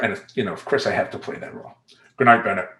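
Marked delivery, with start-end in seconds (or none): 2.2-2.7 firm goodnight, a little louder; stern dad. Shown as a demonstration. Dad has to play that role at bedtime, stern. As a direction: lightly play the stern father ending bedtime. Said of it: "in my stern dad voice".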